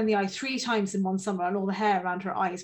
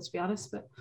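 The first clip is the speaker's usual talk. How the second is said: normal talking voice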